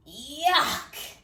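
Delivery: high disgusted yuck; spluttered. Acted. Wolf kissed by the prince, high spluttering disgust. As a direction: spit it out in disgust. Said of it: "spluttered the big bad wolf".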